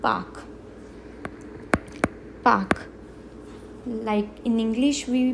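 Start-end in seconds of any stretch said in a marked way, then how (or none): 0.0-2.9 park said singly, the k sounded; park, park, each ending on an audible k; final consonant pronounced. Shown as a demonstration. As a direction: model park as a lone word with its final k. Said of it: "we are going to pronounce".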